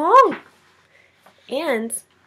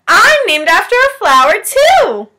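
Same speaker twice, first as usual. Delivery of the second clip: higher, louder, delighted teacher; bright teacher voice; 10 semitones higher, 9 dB louder than usual. Acted. Mrs. Twinkle tells her class about her flower name, delighted and high. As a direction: announce it proudly, pitched way up.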